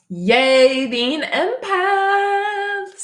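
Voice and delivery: high, drawn-out loud "yay" cheer; mock cheer. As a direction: belt out a silly, slow celebration. Mock cheer about being empaths.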